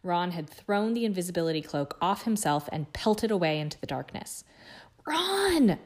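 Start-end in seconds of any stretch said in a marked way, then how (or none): 5.0-5.8 high, wailing call; anguished moan. A narrator's reading. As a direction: wail the name in dismay. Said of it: "Hermione moaned".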